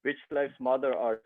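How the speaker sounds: retold reborn child. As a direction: play the newly revived boy asking who they are.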